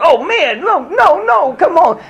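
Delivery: very high, anguished retold plea; distressed protest. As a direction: beg them to stop hurting her. Retelling his reaction to wife's pain.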